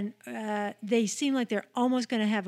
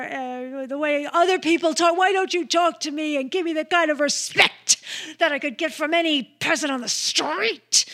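Faye Dunaway; 5 semitones higher, 6 dB louder than usual. Retold or imitated. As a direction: demand respect like an outraged diva mother. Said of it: "Like that, you know, Faye Dunaway".

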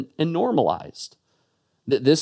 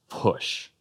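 a hushed, breathless whisper of one word; 6 dB quieter than usual. Acted. Preacher whispering Jesus's command to the congregation.

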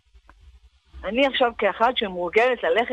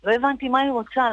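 usual speaking voice

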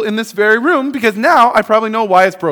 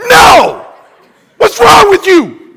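higher, louder shout of No; outraged parent; 11 semitones higher, 9 dB louder, much slower than usual. Acted. Parent outraged by the question of feeding babies Doritos, shouting. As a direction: yell at the absurd idea.

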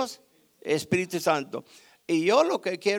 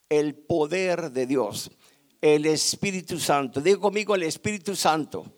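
ordinary delivery

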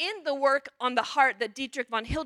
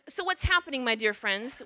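ordinary delivery

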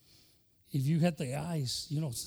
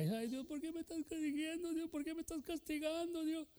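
high, soft mocking whimper of a complainer; preacher mocking a brother who cries over correction; mock whining crybaby; 13 semitones higher, 8 dB quieter than usual. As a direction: imitate a believer whining to God. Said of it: "Yo no voy a ponerme a llorar".

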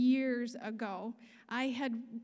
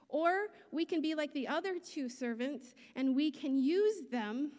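ordinary delivery

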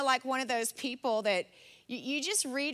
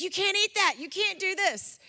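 higher-pitched strict mom nagging; mock scolding; 6 semitones higher than usual. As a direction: lay down the rules, hammed-up and high. Imitating her strict past self.

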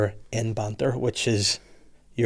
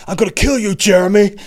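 high, louder cartoonish rage; comic outraged cry; mock threat; 9 semitones higher, 6 dB louder than usual. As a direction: threaten in a hammed-up raised voice. Acted threat in retold film.

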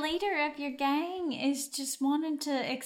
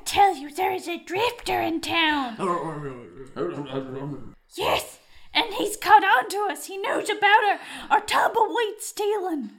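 alarmed report; 3 semitones higher, 5 dB louder than usual. Acted.

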